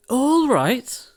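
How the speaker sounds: high, indignant cry. A narrator's reading. Cindy crying out to the fairy in indignation. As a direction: squeal it back in disbelief. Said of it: "cried Cindy".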